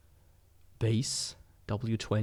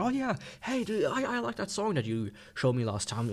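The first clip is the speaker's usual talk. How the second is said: starts much higher, a hammed-up buddy greeting; mock chummy friend; 9 semitones higher than usual. Imagining two owners meeting, mock friend voice high. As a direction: play a gushing dude mocking the idea.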